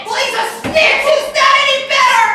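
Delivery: furious shout. Acted. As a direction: scream it back in rage. Shouting match.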